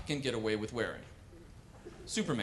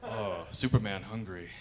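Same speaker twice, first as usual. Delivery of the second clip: stoned Superman; 7 semitones lower, 6 dB quieter than usual. Acted. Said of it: "strung out on marijuana".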